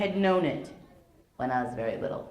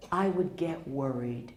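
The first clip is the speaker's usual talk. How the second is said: whispered, small and worried; hushed childlike worry; 7 semitones lower, 6 dB quieter, slower than usual. Retold or imitated. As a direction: breathe it out like a scared child. Child's fear retold.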